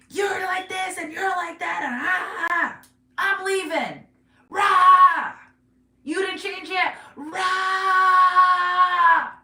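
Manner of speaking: high, loud hammed-up tirade ending in roars; mock ranting. Reader acts out a nagging partner threatening to leave, mock ranting. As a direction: rant and roar like a fed-up partner.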